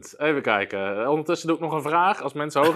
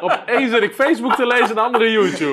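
laughing; 10 semitones higher, 10 dB louder than usual. Real, not acted. Joking about watching two phones.

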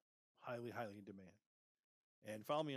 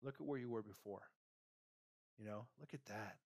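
normal talking voice

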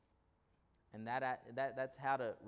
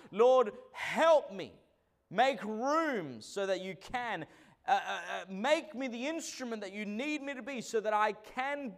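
full-force preaching; 5 semitones higher, 6 dB louder than usual. Acted. Pastor rousing the congregation about saving souls, loud preaching. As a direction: drive each line home to the church.

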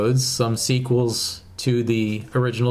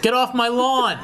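put-on high, laughing cranky old codger; old prospector; 13 semitones higher than usual. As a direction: yell it like a grouchy old-timer. Grumpy old man reply to whippersnapper.

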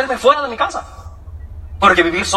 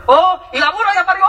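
high-pitched comic marvelling; hammed-up rustic news over the phone; amazed villager; 8 semitones higher than usual. Caller learns the donkey gave birth, mock amazement.

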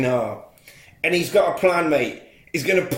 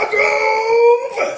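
hammed-up shout, much higher and louder; mock villain yell; 6 dB louder than usual. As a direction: bellow the name like a film villain. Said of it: "And he shouts".

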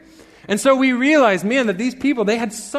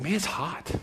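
soft, wilting fan grumble; mock weary complaint; 10 dB quieter than usual. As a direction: complain under your breath about the heat. Imitating wilting fans complaining.

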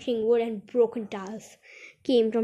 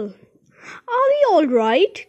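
slow, high, loud worried question; anxious cry; 7 semitones higher, 9 dB louder, much slower than usual. A narrator's reading. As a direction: stretch the question out in alarm. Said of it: "cried Grandpa Joe".

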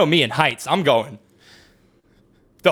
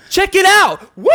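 much higher, louder hollered whoop; thrill-ride whoop; 13 semitones higher, 5 dB louder than usual. Retold or imitated. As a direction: holler like a daredevil hanging off the coaster. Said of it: "Jeff goes".

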